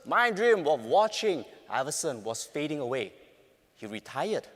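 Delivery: ordinary talk, nothing marked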